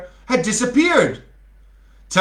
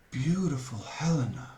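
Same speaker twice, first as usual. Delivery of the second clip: much softer, low romantic murmur; 3 semitones lower, 11 dB quieter than usual. A narrator's reading. Lysander gazing at Helena, soft lovestruck murmur. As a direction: breathe her name like a lover. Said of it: "murmured Lysander".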